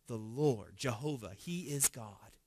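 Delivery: soft, reverent declaration; hushed confession. Retold or imitated. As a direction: speak it low like a bowed crowd. Crowd's reverent confession, read aloud.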